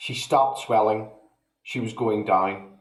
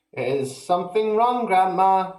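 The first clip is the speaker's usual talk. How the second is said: higher, mock-polite boyish ask; polite question; 8 semitones higher, 3 dB louder than usual. A narrator's reading. George asking grandma politely, higher. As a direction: ask sweetly, feigning concern. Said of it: "asked her politely".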